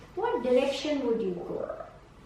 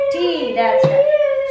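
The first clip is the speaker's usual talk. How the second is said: much higher, louder and slow, thrilled; delighted praise; 13 semitones higher, 11 dB louder, much slower than usual. Real, not acted. Tutor cheers the child's right letter, thrilled and slow.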